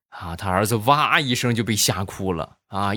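normal talking voice

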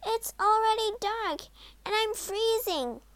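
put-on high, urgent child's complaint; distressed girl. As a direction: plead in a high, anxious voice. Frightened Gretel wakes her brother.

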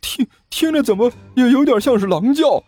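high, spooked henchman; jittery high-voiced alarm; frightened stammer. A narrator's reading. Frightened sidekick hears a howl.

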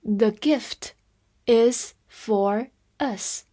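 very slow, pausing between words; slow teacher's model reading. Shown as a demonstration. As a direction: spread the words out for learners. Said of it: "来慢读一遍".

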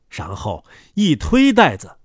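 ordinary delivery

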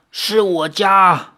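slow, aged granny voice; a kindly old woman, unhurried; old woman's voice. Acted. The old granny tells the injured Xiaoyao where he is, in a slow, aged voice. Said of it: "苍老的声音".

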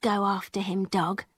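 very soft, low order to the dog; girl softly setting her dog on the peddler; quiet command. A narrator's reading. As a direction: murmur it down to the dog. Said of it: "she said".

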